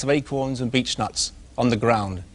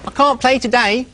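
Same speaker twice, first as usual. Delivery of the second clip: high, eager little pig voice; little pig calling to a friend, high and eager; 10 semitones higher, 4 dB louder than usual. Acted. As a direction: call out proudly as the little pig. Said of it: "he called to his friend".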